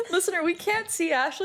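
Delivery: high laughing through the words. Real, not acted. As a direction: laugh while you say it. Friend hurt comically.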